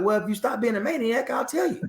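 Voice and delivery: high-pitched commander voice laughing through it; teasing general. Retold or imitated.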